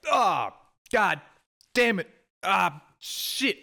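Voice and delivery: high, loud exasperated swearing; disgusted cursing. Retold or imitated. Cursing to herself after biting into moldy bread, high loud disgust. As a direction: groan out the curses, way up high.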